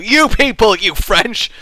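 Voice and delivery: "you people" thrown out loud and high; mock accusation. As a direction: point the finger with a hammed-up "you people".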